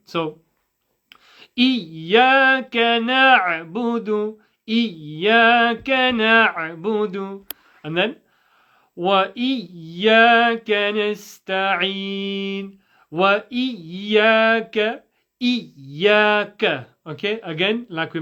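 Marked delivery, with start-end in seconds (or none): 1.6-7.4 slow chanted إياك نعبد, ya shadda held firm; slow recitation. Shown as a demonstration. Teacher modelling recitation for students. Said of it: "commit to the ya shadda". 9.0-16.9 وإياك نستعين chanted slowly, ya shadda committed; slow recitation. Shown as a demonstration. Teacher modelling the ya shadda. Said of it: "commit to the ya shadda".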